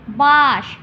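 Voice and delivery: বাঁশ loud, as a single syllable; said in one go. Shown as a demonstration. Teacher proving it is one beat, বাঁশ said loud in one go. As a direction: push out বাঁশ in one unbroken sound. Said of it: "একবারই উচ্চারণ হচ্ছে তো".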